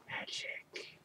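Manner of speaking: unvoiced breathy whisper of magic; spooky whispered echo; eerie whisper. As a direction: whisper it like a spell.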